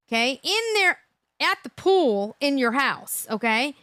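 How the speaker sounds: ordinary talk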